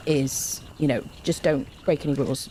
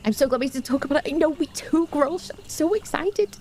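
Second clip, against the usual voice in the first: excited squeal; 10 semitones higher than usual. Real, not acted.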